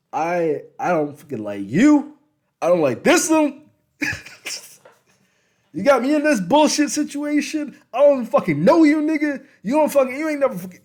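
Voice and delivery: high, loud furious rant; a wife shouting at him over the baby; furious yelling woman. Retold or imitated. Wife yelling at him over the baby, furious.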